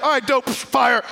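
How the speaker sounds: loud, high, offhand; casual cool. Retold or imitated. God answering Elijah's one prayer, loud and offhand. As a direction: toss it off like it's nothing. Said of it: "God's just like".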